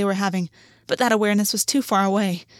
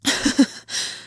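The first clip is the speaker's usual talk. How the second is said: airy low chuckle; soft breathy laugh. A narrator's reading. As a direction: laugh under your breath. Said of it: "and August laughed".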